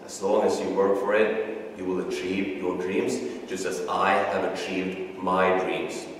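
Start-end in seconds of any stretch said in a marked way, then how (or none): none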